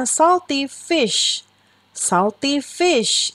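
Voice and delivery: put-on teacher modelling salty fish for children; slow model reading. Shown as a demonstration. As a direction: spell out salty fish slowly for the class. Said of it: "teacher models phrase".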